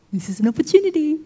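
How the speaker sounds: quick, lifted scheming enemy; gleeful opportunist. Acted. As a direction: pounce on the chance in a put-on voice. Voices enemy seizing his chance.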